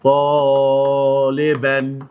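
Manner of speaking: طالبا stretched very slowly with long final a; drawn-out ending. Shown as a demonstration. Teacher slowly stresses the tanween ending for students. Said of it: "ها، هقول إيه؟".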